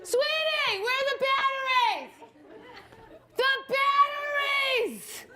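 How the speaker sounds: high, drawn-out mock holler; hammed-up calling out, stretching the last word; screaming husband. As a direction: yell through the house like a helpless husband. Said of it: "My husband will scream my name".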